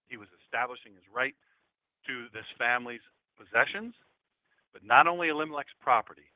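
ordinary talk

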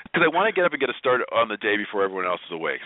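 ordinary talk

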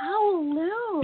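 high, drawn-out playful echo; sing-song echo. Pretend. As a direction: stretch the name up high. Echoing the restaurant name.

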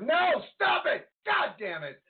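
high-pitched cry of protest; pleading shout. Real, not acted. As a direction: yell in dismay at what's happening. Anguished live reaction to Bayley winning.

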